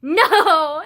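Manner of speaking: loud, high, laughing refusal. Retold or imitated. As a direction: blurt it through a laugh. Laughing refusal of her anxiety.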